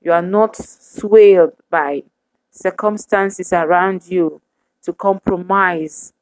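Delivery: ordinary talk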